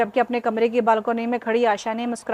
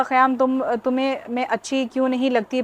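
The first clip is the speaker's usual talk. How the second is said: ordinary delivery, nothing marked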